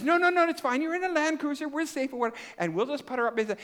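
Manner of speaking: high, excited, brushing off her worry; overeager reassurance. Retold or imitated. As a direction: talk her into it, way up high and eager. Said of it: "I says".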